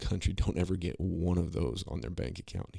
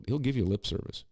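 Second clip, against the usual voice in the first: normal talking voice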